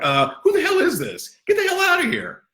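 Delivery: hammed-up fed-up squeal; mock annoyed reaction. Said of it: "You'd be like".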